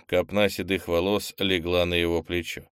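plain reading voice

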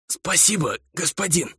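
put-on rasping young man's thanks; hoarse. A narrator's reading. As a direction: croak it out, short of breath. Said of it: "прохрипел парень".

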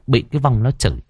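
ordinary delivery

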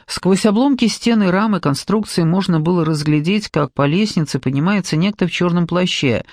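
plain reading voice

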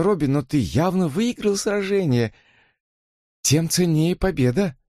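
high, pleading sister voice; earnest sister. Acted. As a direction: plead with a troubled brother. Susan voiced in the story.

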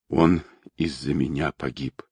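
low, soft, tearful confession; hushed, guilty sorrow; grief-stricken. A narrator's reading. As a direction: blame yourself quietly, voice sunk low. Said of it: "Она громко заплакала".

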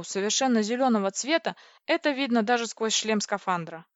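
ordinary delivery

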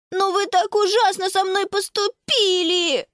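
put-on high, wailing little-boy complaint; crying-out child. A narrator's reading. Little brother reproaching the girls near tears, crying out. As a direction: cry it out as a hurt small boy. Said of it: "кричал Якоб".

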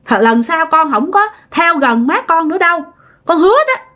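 plaintive promise, voice lifted; pitiful. Retold or imitated. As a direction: imitate a child earnestly begging to be believed. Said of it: "Nói vậy nghe tội nghiệp quá".

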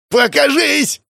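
high, loud hysterical yell; hysterical scream. A narrator's reading. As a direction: scream it, pitch shooting up. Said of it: "истерично заорал убийца". (истеричный крик)

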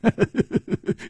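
sudden breathy high laugh; 失声大笑; bursting laugh. Acted. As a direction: let a laugh escape. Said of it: "胡铁花失声笑道".

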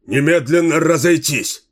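loud barked order; commanding shout. A narrator's reading. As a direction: order the crowd away, loud. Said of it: "скомандовал капитан Королевской гвардии".

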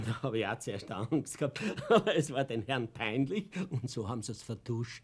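spricht durch Lachen hindurch; amüsiert über die Vertuschung; lachend erzählt. Acted. (lachend erzählen)

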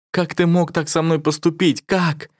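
high desperate cry. A narrator's reading. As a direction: cry out in anguish. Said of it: "и закричала".

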